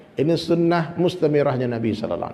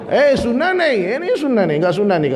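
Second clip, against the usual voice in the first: mock scolding shout; 6 semitones higher than usual. Preacher mimicking a sheikh lecturing him on armpit hair, scolding cry. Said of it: "Dia langsung teriak".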